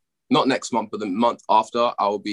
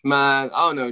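normal talking voice

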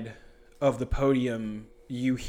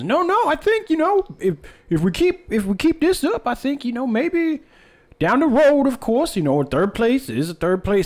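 put-on high, loud old coach character voice; Charles the old man, insistent; 12 semitones higher, 9 dB louder than usual. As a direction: press the point in a high, excitable old man voice.

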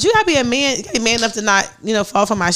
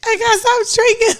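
high silly; 11 semitones higher than usual. Shown as a demonstration. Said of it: "saying stop in, like, different ways".

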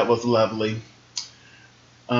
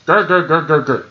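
playful fake growls, a bit louder; mock growling grr; 3 semitones higher, 3 dB louder than usual. Vlogger mocking Miz with playful growls.

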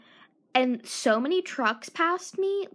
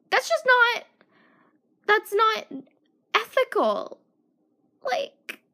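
much higher exasperated protest; incredulous; 8 semitones higher, 4 dB louder, slower than usual. Real, not acted. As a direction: squeak it in disbelief. High-pitched incredulous protest.

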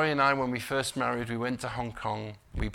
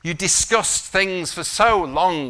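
forceful preaching warning; 5 semitones higher, 8 dB louder than usual. Real, not acted. Preacher warning against a life played safe, forceful preaching. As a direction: drive the warning home, stretching key words.

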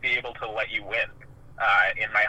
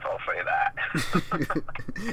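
laughing; 3 semitones higher, much slower than usual. Real, not acted. Laughing admission of moody years.

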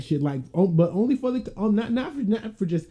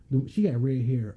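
normal talking voice

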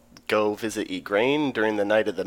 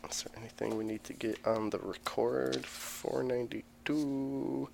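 whispering; 14 dB quieter than usual. Real, not acted. GM whispers to self.

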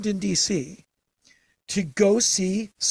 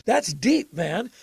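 higher, louder mock awe; 5 semitones higher, 3 dB louder, much slower than usual. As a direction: tease it as something profound. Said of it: "Like, like".

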